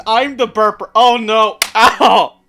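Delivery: usual speaking voice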